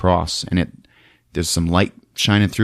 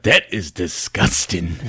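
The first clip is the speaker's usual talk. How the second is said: deadpan disgust; 4 dB quieter than usual. Retold or imitated. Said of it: "Dick Miller's like".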